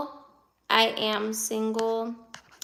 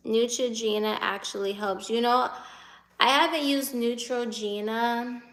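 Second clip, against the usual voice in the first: ordinary talk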